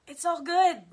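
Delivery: loud, high giggly exclaim; excited. Real, not acted. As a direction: burst out cheerfully over the joke. Laughing at own joke.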